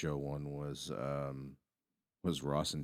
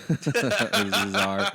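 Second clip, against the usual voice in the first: high laughing through the words; 10 dB louder, much slower than usual. Real, not acted. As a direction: crack up mid-sentence. Laughing mid-sentence.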